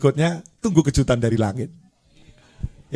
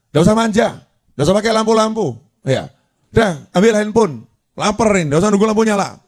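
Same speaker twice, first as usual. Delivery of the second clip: higher, bossy mock husband; husband scolding his wife at night, mock-stern; harsh husband; 6 semitones higher, faster than usual. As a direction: boss her around like a grumpy husband. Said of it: "Suami bilang".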